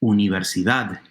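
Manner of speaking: "universidad" with DAD stressed; stress on last syllable. Shown as a demonstration. Teacher demonstrates Spanish stress, "universidad" stressed on final DAD. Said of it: "the stressed syllable is the very last one".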